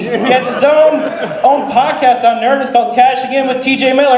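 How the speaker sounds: loud, high announcer voice introducing the headliner; announcer hype. Acted. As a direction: pump up the crowd like an MC.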